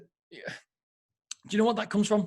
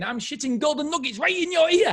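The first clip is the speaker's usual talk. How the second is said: quoting Kevin Hart; 11 semitones higher, 4 dB louder than usual. Retold or imitated. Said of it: "He's saying".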